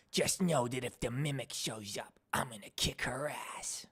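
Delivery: hushed, half-whispered threat; character voice demo. Shown as a demonstration. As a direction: drop to a soft, whispery put-on voice. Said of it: "It's just me, you know".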